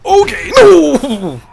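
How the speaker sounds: long drawn-out panicked shout. Real, not acted. Screaming "no" as the game character falls. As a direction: stretch out a loud, high cry of "no".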